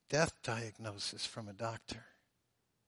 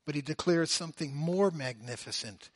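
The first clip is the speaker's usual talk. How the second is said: usual speaking voice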